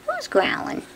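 very high teasing squeak. Real, not acted. Owner squeaking at the growling puppy.

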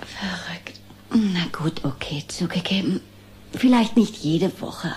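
low, hushed and half-whispered; wistful remembering. Acted. Laura recalls writing to Santa, wistful remembering. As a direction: drift softly back into a childhood memory.

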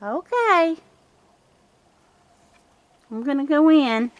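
high, loud call into the yard. Real, not acted. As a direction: call out to see who is left. Calling to raccoons after feeding.

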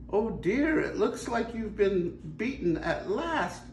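high, light mermaid voice; a sweet sea maiden teasing. Acted. Mermaid teasing the skeleton pirate, high and light.

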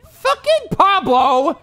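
drawn-out furious cry at the player; angry outburst. Real, not acted. Streamer raging at his fielder, slow angry yell. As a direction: let the name out slow and outraged. Player blames teammate for the error.

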